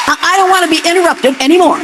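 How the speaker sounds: stern. Real, not acted. Cuts off a heckler, quick.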